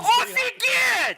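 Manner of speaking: very high, long-drawn yell of amazement; excited yelling. Real, not acted. Amazed by the artifact container, excited yelling. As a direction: yell it high and stretch it right out. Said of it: "Та не ори на нього".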